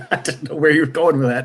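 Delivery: talking through real laughter; laughing. Real, not acted. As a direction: get the words out while laughing. Cracks up at name slip.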